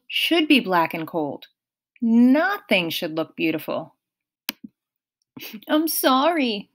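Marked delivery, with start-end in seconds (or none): 5.7-6.7 higher, slow mild sniffle; a touch of tearful apology; understated weepy; sniffling. A narrator's reading. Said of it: "Grover sniffled".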